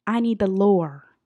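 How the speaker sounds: loud, drawn-out plea; emphatic demand. Real, not acted. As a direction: demand it loudly, stretching the words. Emphatic demand, then repeated normally.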